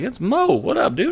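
starstruck greeting. Retold or imitated. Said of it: "I was like".

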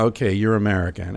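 usual speaking voice

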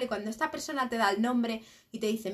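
ordinary talk

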